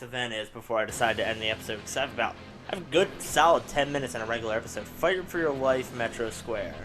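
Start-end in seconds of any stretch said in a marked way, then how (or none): none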